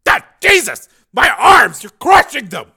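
high, very loud agonized yell; pained, panicked outcry; crying out in pain. Acted. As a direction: yell as if your arms are being crushed. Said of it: "Shelley cried out with pain".

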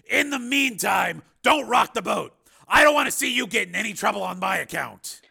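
high, loud, firm warning; forceful Steele. A narrator's reading. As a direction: warn a friend forcefully over the phone.

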